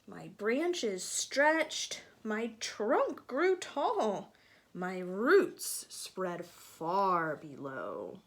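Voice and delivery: slow, lilting storybook reading; reading to children. A narrator's reading. Teacher reading a picture book to children, lilting storybook voice. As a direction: read it gently to the kids.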